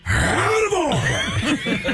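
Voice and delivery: slow, drawn-out, climbing movie-star yell; Al Pacino. Retold or imitated. Actor raging at all the broken washers, climactic screamed impression. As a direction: build the impression to a high, hammed-up scream. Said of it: "cut to me as Al Pacino".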